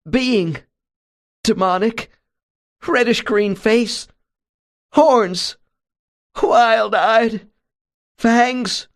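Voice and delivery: high, loud, halting words building to a cry; frantic high voice rising to a wail; terrified description. A narrator's reading. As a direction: gasp out each horror one by one. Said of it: "whispering at first and then loudly".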